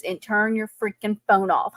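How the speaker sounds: ordinary talk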